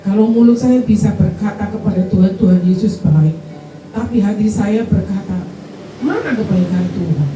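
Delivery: building to full preaching. Acted. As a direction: preach it, rising to a loud plea. Testimony rising to preaching. (khotbah yang makin lantang dan berapi-api)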